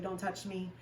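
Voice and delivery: soft, recoiling mock plea; disgusted withdrawal. Retelling refusing her partner's touch, soft and disgusted. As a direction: shrink away quietly, feeling gross. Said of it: "I was like".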